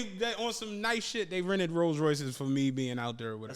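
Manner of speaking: ordinary talk, nothing marked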